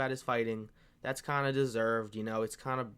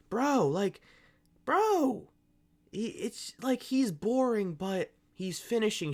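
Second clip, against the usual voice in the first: exasperated outburst; 9 semitones higher, slower than usual. Real, not acted. MMA vlogger pleading that Bilal gets no credit, exasperated. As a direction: plead in disbelief, pitch jumping up.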